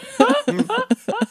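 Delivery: shrieking laugh up high; high squealing laugh. Real, not acted. Laughing at own joke.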